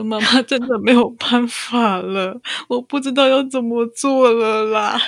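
put-on mother crying with her wailing baby. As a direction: sob out helplessness. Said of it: "跟着他一起哭".

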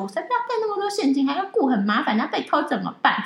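high fast whine sinking back toward normal; mock stubborn complaining, fading; imitating her own petulant refusal; whiny younger self. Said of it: "还念了一大堆说".

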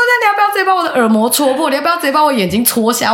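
high fast furious retort; angry retort. Retold or imitated. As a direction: fire it back furious. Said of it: "我超气的".

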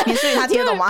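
high, fast, laughing imitation of the anxious ask; laughing mock question. Retelling her friend's question, high and laughing. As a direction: replay the friend's worried question through laughter. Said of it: "你还问我说".

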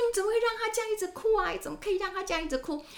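high, fast, fussing granny voice; fretful grandparent. Retold or imitated. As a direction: fret like a doting grandma over a crying child. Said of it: "很多祖父母是舍不得孩子哭的，就说". (模仿心疼孙子的祖父母，高声急促地念叨)

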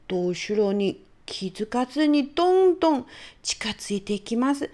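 ordinary delivery, nothing marked

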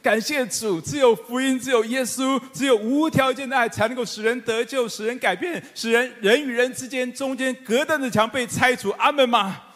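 high, loud, ringing sermon proclamation; fervent pulpit climax, every phrase pushed up; preaching at full force. Acted. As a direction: proclaim it to the whole congregation.

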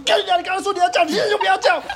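retold furious cursing, very high. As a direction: swear at the neighbor for backing down. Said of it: "反正我就暴怒".

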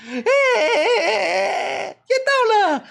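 put-on high, loud childish cheer; faithful toddler imitation. Narrator recreating the toddler's landing cheer, high squeal. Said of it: "我还原得非常的真实".

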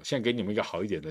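ordinary talk

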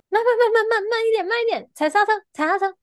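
high, loud nagging repeats; mock a panicky passenger; nagging backseat driver. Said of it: "讨厌人家叫驾驶".

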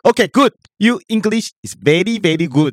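high, slow mock Indian English accent; mock Indian accent praise; Russell Peters accent. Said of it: "你怎么口音变成Russell Peters啦".

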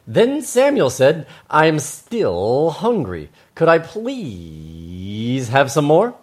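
slow, heavily drawn-out words; animated children's storytelling. Acted. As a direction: stretch the plea out long.

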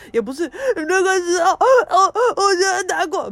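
high, loud, half-laughing sob-talk; crying while talking. Shown as a demonstration. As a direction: show how she talked while crying. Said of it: "边哭边讲". (边哭边讲的示范)

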